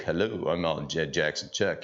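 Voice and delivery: mock the cocky kid with his chest out; puffed-up kid. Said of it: "all helium chested".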